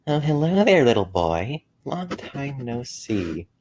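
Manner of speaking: high, slow ghostly greeting; creepy whisper. A narrator's reading. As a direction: leer slowly, like a patient ghost. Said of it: "a very familiar whisper".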